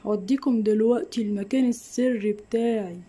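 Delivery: usual speaking voice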